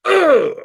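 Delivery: a loud, high strained grunt of rage; angry grunt. Acted. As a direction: heave a furious grunt as the chair goes up. King grunts lifting chair.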